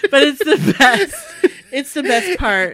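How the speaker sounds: loud, high, laughing protest. Real, not acted. Co-host defending the quiz's best part, laughing loudly. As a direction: insist through real laughter. Both hosts cracking up over quiz.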